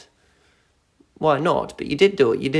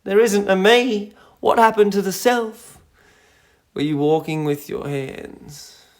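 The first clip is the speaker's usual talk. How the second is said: normal talking voice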